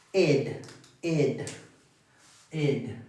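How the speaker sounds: "ed" said as a short "id"; phonetic -ed sound. Shown as a demonstration. Teacher models the ending for students, phonetic -ed sound. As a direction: give each "ed" on its own, as "id". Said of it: "pronunciarlos con el sonido fonético".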